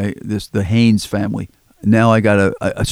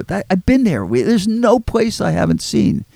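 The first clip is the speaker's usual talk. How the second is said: emphatic insistence; 10 semitones higher than usual. Retold or imitated. Insisting to friend Torp.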